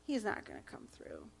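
soft, low, doubtful murmur; doubting heart. Pretend. The inner heart doubting God, soft and low. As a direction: let the doubt slip out quietly. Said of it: "my heart is going".

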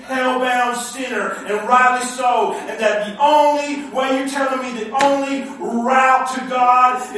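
loud, rising sermon shout; preaching at full force. Acted.

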